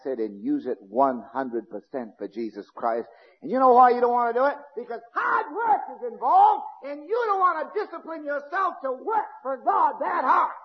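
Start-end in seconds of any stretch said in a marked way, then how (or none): 3.4-10.5 fiery preaching. Real, not acted. Preacher rebukes lazy members.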